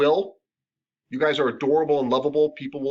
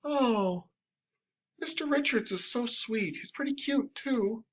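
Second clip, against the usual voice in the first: higher and softer, a dreamy swoon; swooning client; 10 semitones higher, 7 dB quieter, slower than usual. Retold or imitated. Client with a crush gushing over her massage therapist, swooning. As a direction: sigh it out like a smitten client. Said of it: "for me to be like".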